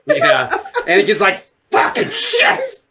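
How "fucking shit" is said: high, strained, drawn-out curse; hammed-up exasperation; mock frustrated outburst. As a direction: squeal the curse in comic fury. Acting out Vader's frustrated reaction.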